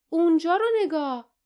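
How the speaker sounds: high, loud call to look; excited shout. A narrator's reading. Barda points companions to the light, high urgent shout. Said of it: "باردا فریاد زد".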